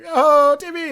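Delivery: very high, imitated fan-like cry; mock excited greeting. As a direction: burst out as if spotting a celebrity. Acted excited greeting of Timmy.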